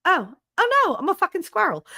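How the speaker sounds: high, rising squirrel panic; a squirrel realizing it left the gas on; panicking squirrel. Retold or imitated. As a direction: squeal it as a squirrel in sudden alarm. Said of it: "they're like".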